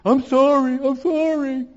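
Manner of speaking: high, loud put-on kid voice; whining child's apology. Acts out kids caught misbehaving.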